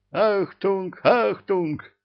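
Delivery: very high, loud announcer cry; inhuman roar. A narrator's reading. Spell-boosted cat calling for attention, roaring. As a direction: blare it out in an unearthly voice. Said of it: "взревел нечеловеческим голосом умничка".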